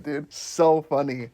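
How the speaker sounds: normal talking voice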